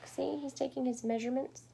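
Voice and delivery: usual speaking voice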